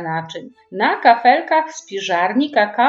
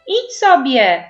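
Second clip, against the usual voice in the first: put-on higher, louder annoyed kid; cross little girl's shout; 5 semitones higher, 5 dB louder, slower than usual. A narrator's reading. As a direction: snap it out as a cry to go away. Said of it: "krzyknęła Basia".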